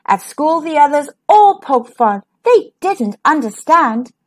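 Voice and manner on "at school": usual speaking voice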